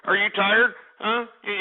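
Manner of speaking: ordinary delivery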